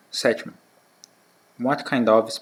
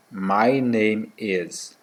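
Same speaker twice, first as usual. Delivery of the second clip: slow model phrase; much slower than usual. Shown as a demonstration. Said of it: "slow model for the class".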